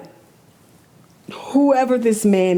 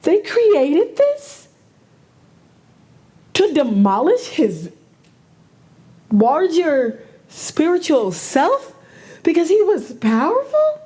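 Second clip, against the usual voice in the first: upset; 9 semitones higher, 4 dB louder than usual. Real, not acted. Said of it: "Clearly, I'm upset".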